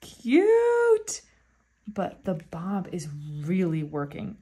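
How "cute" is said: a high, loud squeal with a laugh. Real, not acted. Fan delighted by a photo card, laughing and squealing high.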